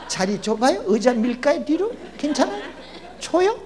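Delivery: high, eager mock fawning over a lady passenger; fawning politeness. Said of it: "별 거지 같은 소리를 다 하잖아요". (남의 여자에게 잘 보이려고 높은 목소리로 알랑거리는 흉내)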